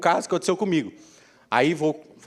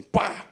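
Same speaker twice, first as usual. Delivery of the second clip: sudden imitated bang; sharp spoken slam sound effect. Imitating ex slamming the laptop on his finger, sharp bang. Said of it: "fechou o notebook no meu dedo, assim".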